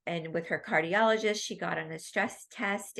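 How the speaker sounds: usual speaking voice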